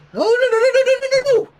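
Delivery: rapid high, loud string of no; rushing in to stop the toddler; frantic repeated no; panicked no. Acted. Panicked protest at toddler biting.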